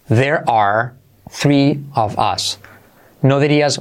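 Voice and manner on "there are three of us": very slow, word by word; "There are" as two separate, drawn-out words; full uncontracted form. Shown as a demonstration. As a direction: model it slowly with gaps between words. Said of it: "Incluso contraído".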